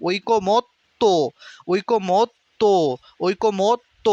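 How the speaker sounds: ঐকমত্ত split as "oikomot... to"; closed t, then to. Shown as a demonstration. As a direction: pause on the t of ঐকমত্ত before "to". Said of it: "এই জন্য তয়ের নিচে হসন্ত". (ঐকমত্ত ভেঙে "ওইকোমোৎ... তো")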